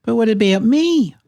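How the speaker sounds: high, plaintive little bird voice; left-out character's high-pitched question; Kiwi. A narrator's reading. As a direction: pipe up high, insisting on being included. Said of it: "asked Kiwi".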